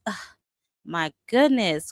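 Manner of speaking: normal talking voice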